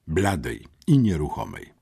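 usual speaking voice

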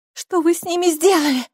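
high-pitched indignant demand. A narrator's reading. Girl accuses stranger over sleeping parents, indignant. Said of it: "возмутилась Сьюзи".

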